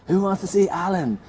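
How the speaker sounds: high, loud host call to the crowd; rally the room like an MC; showman's big question; hyping the crowd. Retold or imitated. Comedian hyping crowd on stage.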